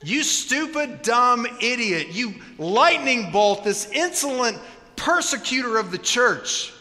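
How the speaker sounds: angry God. Pretend. God confronting Saul on the road, booming furious rebuke. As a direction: thunder it at him like a wrathful judge. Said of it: "that's the response you expect from God".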